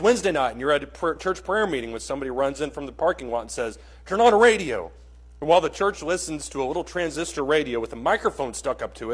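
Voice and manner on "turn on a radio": urgent call. Acted. Man bursting into prayer meeting.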